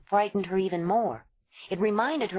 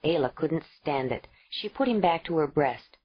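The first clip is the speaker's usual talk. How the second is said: usual speaking voice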